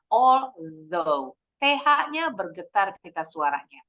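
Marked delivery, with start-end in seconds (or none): 0.1-1.3 slow, measured announcement of the word; slow down and model although; careful teacher pace; slow careful reading. Shown as a demonstration. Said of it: "teacher slowing to model".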